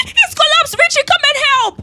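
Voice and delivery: high, loud cry of alarm; frantic high-pitched yelling; panicked screaming. Acted. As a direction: shout urgently for help. Collapse, frantic calls for help.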